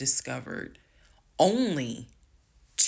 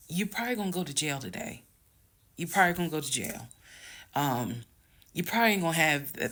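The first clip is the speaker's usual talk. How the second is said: self-warning; 3 semitones higher, faster than usual. Retold or imitated. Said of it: "I had enough wherewithal to be like".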